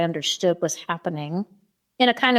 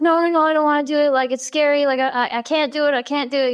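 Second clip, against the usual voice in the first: high-pitched, hurried mock child pleading; imitated panicky protest, pitched up; retold scared refusal; 7 semitones higher than usual. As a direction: plead like a kid who won't do it. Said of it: "And I was scared".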